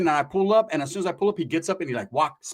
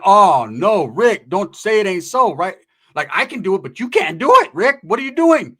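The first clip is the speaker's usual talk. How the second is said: loud mock dismay; 3 semitones higher, 8 dB louder than usual. Friend urging [name] on the phone not to give in, loud dismay. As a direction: plead with him in exaggerated disbelief.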